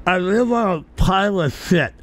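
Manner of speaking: "I live on a pirate ship" said holding the tongue, thick slurred; "pirate ship" mushed with tongue held. Shown as a demonstration. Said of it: "Hold your tongue".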